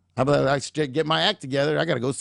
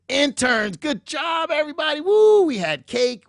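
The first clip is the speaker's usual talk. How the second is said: party cheer; 11 semitones higher, 3 dB louder than usual. Retold or imitated. Party cheer at interns' farewell.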